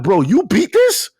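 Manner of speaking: high, loud disbelieving question; retold incredulity. Gamer asking his friend about a brutal boss, incredulous. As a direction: blurt it out in hammed-up disbelief.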